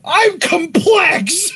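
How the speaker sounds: slow, high mocking echo; imitating. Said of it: "that was funny".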